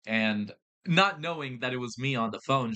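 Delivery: usual speaking voice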